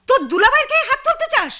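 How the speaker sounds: very high, indignant cry; scandalised high-pitched challenge; outraged rebuke. Acted. Sister rebukes brother for grabbing husband.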